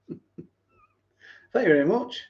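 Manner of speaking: slow, laughing thank you. Real, not acted.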